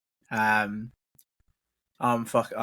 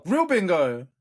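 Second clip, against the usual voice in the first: surprised; 7 semitones higher, 5 dB louder than usual. Real, not acted. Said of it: "incredulous echo".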